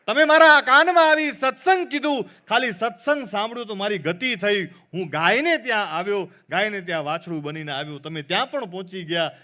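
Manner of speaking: a lightly voiced child explaining his rebirths; child's voice. Newborn prince reveals his past lives. (ઊંચા અવાજે બાળક બોલતું)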